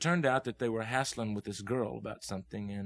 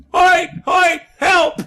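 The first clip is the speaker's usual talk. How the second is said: very loud, high-pitched shouts; Hugh yelling for help; 16 dB louder, much slower than usual. Retold or imitated. Storyteller imitates a friend calling him for help, yelling. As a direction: holler out in short, urgent bursts. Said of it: "I heard Hugh yelling".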